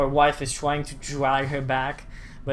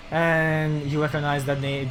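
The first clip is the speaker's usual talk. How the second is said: usual speaking voice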